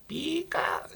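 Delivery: high squeaky Pikachu cry; Pikachu sound. Retold or imitated. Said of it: "like, the Pikachu sound".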